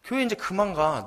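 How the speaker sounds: high-pitched, acted exasperated outburst; angrier son. Son scolds forgetful mother, acted high-pitched exasperation. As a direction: burst out at mother, then drag out the complaint. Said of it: "아들이 더 화가 나서".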